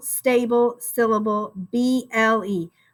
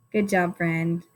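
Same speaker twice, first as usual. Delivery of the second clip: ordinary delivery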